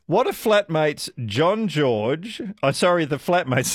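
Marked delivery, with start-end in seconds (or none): none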